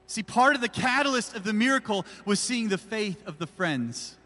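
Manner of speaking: loud, driving sermon cadence; full-force preaching. Acted. Pastor rallying the church to evangelize, full-force preaching. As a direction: preach it out to the back row.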